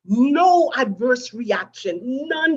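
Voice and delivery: proud emphatic news, voice lifting; emphatic relief. Real, not acted. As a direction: lean into the good news slowly. Stressing the good news.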